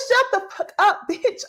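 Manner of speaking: high-pitched mock snap breaking into laughter; amused retold curse. Host retells castmate's clapback to viewers, high and laughing. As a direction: imitate the clapback, high and tickled.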